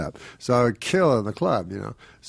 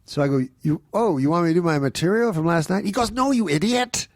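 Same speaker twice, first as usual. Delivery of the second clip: much higher, impatient voice throughout; exasperated casting director; 8 semitones higher than usual. Retold or imitated. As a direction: talk down to him in a pitched-up huff. Casting director berates Norm.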